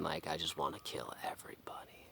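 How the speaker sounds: whispered, fading to near silence; sinister whisper. Retold or imitated. The inner psychopath speaking to himself, chilling whisper. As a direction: hiss it like a dark inner voice.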